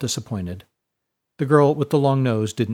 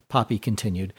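ordinary delivery, nothing marked

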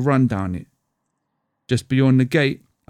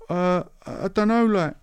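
nervous, higher pitched schoolboy; voice going up; 8 semitones higher than usual. Retold or imitated. Scared schoolboy answers the rude boy, voice going up. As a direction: let the voice jump up in panic. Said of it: "My voice goes up an octave".

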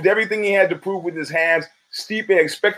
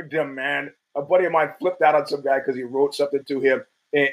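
normal talking voice

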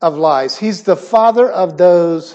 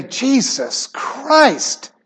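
slow, drawn-out exasperated "Jesus Christ" pitched higher; frustrated; 6 semitones higher, 4 dB quieter, much slower than usual. Shown as a demonstration. Preacher shows how a frustrated person says "Jesus Christ". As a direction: let "Jesus Christ" out slowly like a fed-up groan. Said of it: "be frustrated and say".

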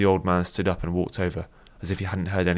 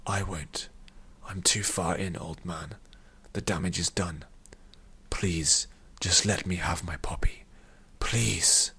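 soft, breath-heavy pleading; small, sad voice; 6 dB quieter, slower than usual. A narrator's reading. Defeated addict begs the old man, small sad pleading. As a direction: plead quietly, fading towards a whisper. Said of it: "in a small, sad voice".

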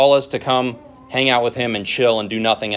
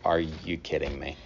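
softer, muttered exasperation; under your breath, fed up and tired; frustrated disbelief; 6 semitones lower, 11 dB quieter than usual. Retold or imitated. Imagining a tired disciple grumbling at the crowd, mild exasperation.

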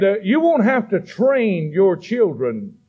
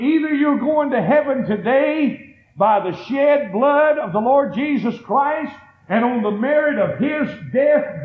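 ordinary talk

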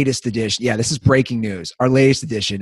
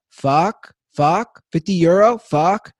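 put-on foreign girl voice; 7 semitones higher, 3 dB louder, much slower than usual. Bulgarian girl quoted in brothel.

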